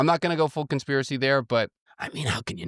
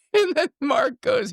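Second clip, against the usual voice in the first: much higher, squeaking through real laughter; laughing; 3 dB louder than usual. Real, not acted. Host retelling the funny email, laughing. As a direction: barely get it out for laughing. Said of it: "That really makes me laugh".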